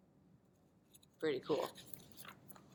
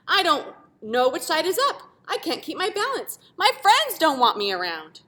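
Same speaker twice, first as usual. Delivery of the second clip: distressed shape; 7 semitones higher, 6 dB louder than usual. Acted. Shape's desperate complaint.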